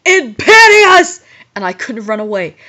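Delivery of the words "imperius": loud, high, drawn-out curse; shouted spell. A narrator's reading. Voldemort casting at the heroine, shouted spell. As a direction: bellow it like a villain's spell. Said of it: "but then Voldemort shouted".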